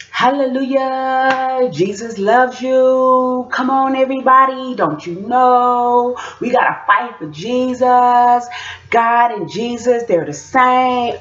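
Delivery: high, drawn-out preacher cry; slow, high mock sermon; preaching child. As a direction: imitate a kid preaching, stretching each phrase out high. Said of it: "And Kai was preaching".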